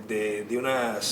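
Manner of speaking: ordinary delivery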